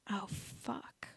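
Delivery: soft alarmed whisper. A narrator's reading. Hermione seeing the fight coming, whispering. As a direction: breathe it in dismay. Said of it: "whispered Hermione".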